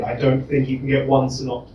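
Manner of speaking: ordinary delivery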